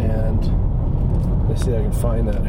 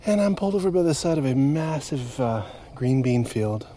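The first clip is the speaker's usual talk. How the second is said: calm and soft; 3 semitones lower, 7 dB quieter than usual. Real, not acted. Calm sunset update, softer.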